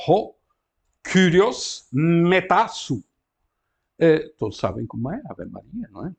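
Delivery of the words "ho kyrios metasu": "kyrios metasu" drawn out slowly, stress on kyrios; Greek read slowly. Shown as a demonstration. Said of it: "Está aqui a transcrição". (leitura lenta de "kyrios metasu")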